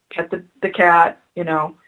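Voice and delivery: ordinary talk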